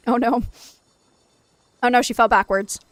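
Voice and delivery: sudden worried exclamation; alarmed. Real, not acted. Baby falls on camera.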